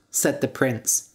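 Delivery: ordinary delivery, nothing marked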